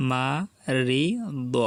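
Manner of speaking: মারিদ stretched very slowly, each sound held; drawn out. Shown as a demonstration. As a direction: drawl মারিদ out slowly for learners. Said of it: "teacher drawls then repeats". (মারিদ খুব টেনে ধীরে ধীরে)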